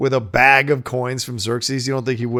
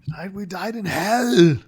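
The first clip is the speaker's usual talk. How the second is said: mock Scottish war cry, slow and raised in pitch; Gerard Butler imitation; 6 semitones higher, slower than usual. Fan quotes a favorite movie line, imitated Scottish accent. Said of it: "the way he says hell with that Scottish accent".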